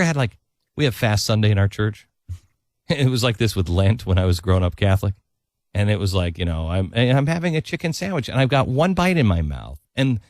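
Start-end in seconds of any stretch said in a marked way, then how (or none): none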